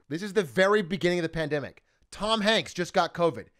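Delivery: ordinary talk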